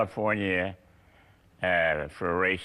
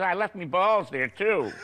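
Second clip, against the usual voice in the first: punchline in a higher imitated Scottish accent; Jackie Stewart Scottish brogue; 10 semitones higher than usual. Jackie Stewart refusing the sprint car drive, in higher mock Scottish brogue. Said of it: "Jackie says in his Scottish brogue".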